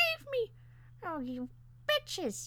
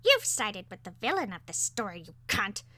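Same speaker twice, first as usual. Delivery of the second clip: loud, accusing bird voice; threatening parakeet; 5 dB louder than usual. A narrator's reading. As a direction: spit out the accusation. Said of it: "was still threatening our lives".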